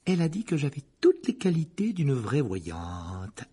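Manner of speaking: put-on slow, self-satisfied girl's voice; girl boasting to her friends, slow and pleased; smug Parvati. A narrator's reading. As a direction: preen over her exam result.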